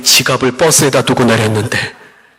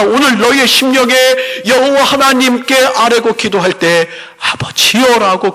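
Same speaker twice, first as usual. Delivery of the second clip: raised, ringing pulpit voice, pressing the point home; preaching; 5 semitones higher than usual. Acted. Pastor to congregation about the loving Father, preaching with fervor.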